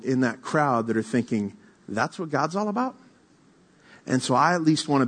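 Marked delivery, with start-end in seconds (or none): 1.9-2.9 incredulous question. Retold or imitated. Voicing doubting onlookers in crowd.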